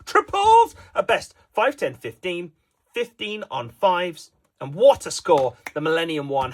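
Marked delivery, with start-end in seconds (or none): 0.1-1.4 excited commentator. Acted.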